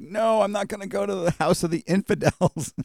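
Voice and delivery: high-pitched comic refusal, breaking into a laugh; mock protest. Preacher imitating the patient refusing the doctor's house, mock protest. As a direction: whine out an exaggerated, laughing objection.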